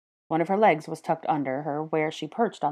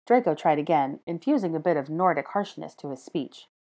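plain reading voice

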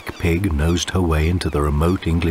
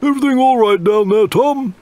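much higher, louder call from above; 5 dB louder than usual. Acted. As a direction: call down the hatch to the boy. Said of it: "the captain called down".

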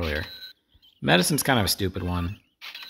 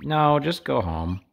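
higher, slow brush-off; slow mocking no; dismissive guard; 7 semitones higher, 4 dB louder, slower than usual. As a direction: shoo her off in a mock voice. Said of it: "And they're like".

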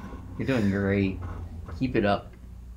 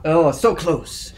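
slow, louder, drawn-out mock disappointment; own catchphrase; 6 semitones higher, 7 dB louder, slower than usual. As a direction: ham up the near miss. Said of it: "I'll be like".

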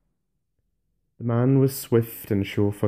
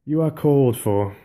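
solemn, louder summons; grave; 4 semitones higher, 5 dB louder than usual. Acted. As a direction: deliver it gravely. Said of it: "he said more gravely".

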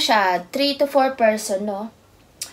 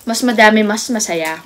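speaking between bites; talking while eating; 5 dB louder, faster than usual. Real, not acted. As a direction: mouth partly full. Seen 0: left woman eats with her hands, puts food in mouth mid-speech.

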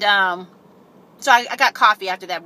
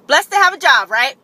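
excited; 6 semitones higher, 9 dB louder than usual. Real, not acted. Cheerful aside about her job.

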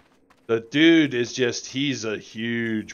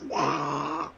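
imitated dragon blast; 4 semitones higher, 3 dB quieter than usual. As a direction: wail it out like an explosion.

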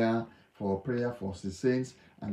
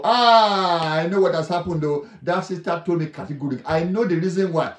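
high, loud blurting tell-all; acting a gossip broadcasting someone's secret at full voice; 5 semitones higher, 8 dB louder than usual. Gossip telling the whole world a sister's secret, loudly.

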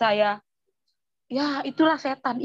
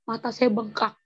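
ordinary delivery

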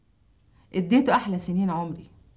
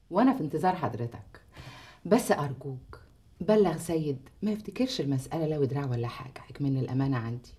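quietly pointed request; 4 semitones lower, 5 dB quieter than usual. Acted. Veiled parting request to the lawyer.

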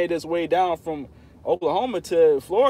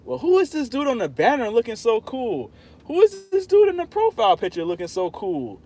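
imitating curious viewers; 7 semitones higher, 3 dB louder than usual. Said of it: "when people come to my channel".

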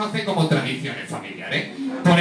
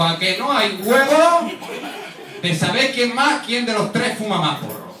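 acted slow dare; 5 semitones higher than usual. Acted brother throwing down a dare.

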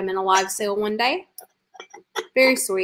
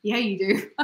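laughing; 3 dB quieter than usual. Real, not acted. Teasing over viewer's glow joke.

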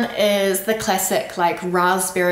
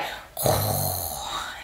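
deep throaty growl of annoyance; low guttural growl; 12 semitones lower, 8 dB quieter than usual. Shown as a demonstration. Showing the French annoyed growl. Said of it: "almost like a low growl".

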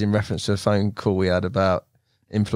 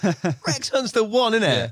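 higher and slow, gleeful; gushing; 9 semitones higher, slower than usual. Real, not acted. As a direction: sing out the name in delight. Host gushing about Rex Hunt.

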